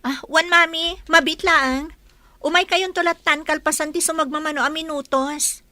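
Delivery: loud answering call. Acted. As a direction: answer mother from another room. Calling back from the kitchen.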